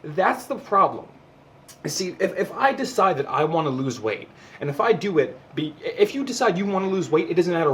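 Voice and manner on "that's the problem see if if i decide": higher, heated burst of real anger; angry outburst. Real, not acted. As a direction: throw it back at the people who pushed you. Lashes out at bullies.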